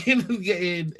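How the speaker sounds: usual speaking voice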